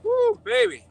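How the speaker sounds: excited whoop. Real, not acted. Reacting to freezing cold outside.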